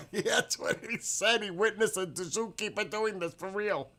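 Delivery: high, loud laughter spilling into words; laughing hard. Real, not acted. Streamer cracking up at a viewer's story, high and loud. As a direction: laugh through the line.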